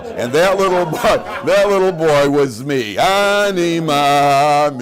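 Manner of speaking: slow, high, loud sung parody ending; sing-song parody of a moving story; mock storyteller. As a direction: ham up the sentimental punchline.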